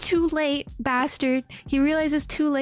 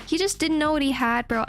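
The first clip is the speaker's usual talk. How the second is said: ordinary delivery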